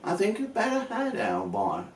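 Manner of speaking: Michael Caine. Retold or imitated. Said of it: "He was quite posh".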